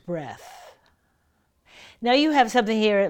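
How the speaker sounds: ordinary delivery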